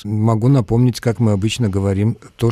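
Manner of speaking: normal talking voice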